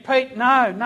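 high, loud pleading cry; retold scream. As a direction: cry out as if to stop him, re-enacting it. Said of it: "I'm up there screaming at him".

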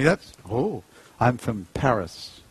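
normal talking voice

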